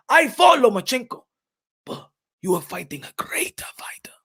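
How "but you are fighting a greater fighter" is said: urgent hushed whisper, no voice at all. Acted. Hyping a fighter before his big chance, in a whisper. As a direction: lean in and whisper it like a secret tip.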